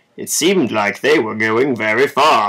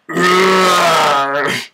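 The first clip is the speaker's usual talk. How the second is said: lion roar; 5 semitones higher, 3 dB louder than usual. Acted. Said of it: "Yelled the lion".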